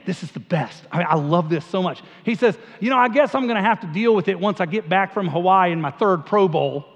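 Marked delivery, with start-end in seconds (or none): 2.8-6.8 aw shucks Southern Peyton Manning. Retold or imitated. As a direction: play the humble Southern quarterback in a Southern accent. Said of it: "sort of talks like this".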